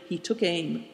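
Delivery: usual speaking voice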